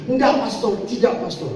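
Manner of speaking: high, pleading and fast; hammed-up stubborn girl insisting; insistent young woman. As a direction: refuse him, she must marry. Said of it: "kata saya".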